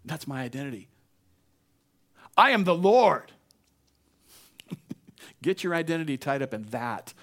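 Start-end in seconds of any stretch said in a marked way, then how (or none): 2.4-3.3 very loud, high proclamation; booming divine declaration. Acted. God answering a discouraged believer's identity, booming proclamation. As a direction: boom it out over every doubt. God's words answer human feelings.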